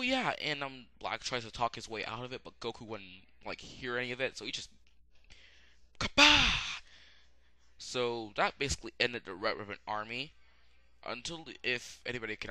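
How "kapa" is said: loud, high comic explosion noise; imitated blast. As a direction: burst out with a high-pitched impact sound. Imitates the blow that follows refusal.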